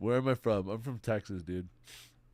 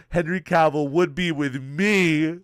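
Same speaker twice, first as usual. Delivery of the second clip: mock declaration; 7 semitones higher, 11 dB louder, slower than usual. Voicing himself in silly dialogue.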